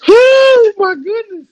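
high, loud, slow crying laugh; tearful laughing; laughing to tears. Real, not acted.

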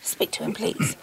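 urgent stage whisper. Acted. Barbara whispering to her maid, handing over the phone. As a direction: hiss it aside so the phone can't hear. Aside so caller won't hear.

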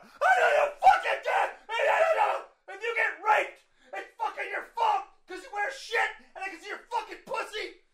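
very high, hammed-up enraged shouting; flipping out. Imitating the man raging at his calm partner, flipping out. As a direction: shriek it as a mock drunken meltdown. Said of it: "he's, like, flipping out".

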